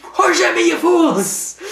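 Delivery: very high, loud squeaky mock rant; childish parody of a villain; shrieky cartoon copycat yell; Harley mimicking Scarecrow. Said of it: "I always like that, like, how she can just".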